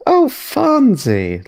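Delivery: high, drawn-out delighted cry; gushing greeting. Acted. Sarix gushing at the orc, high and slow. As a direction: swoon over his name.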